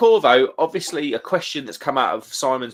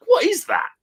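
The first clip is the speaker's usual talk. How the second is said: stretched-out high what-the-hell; baffled disbelief; 4 dB louder than usual. Real, not acted. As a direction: sound utterly bewildered by it. Repeating the question, baffled.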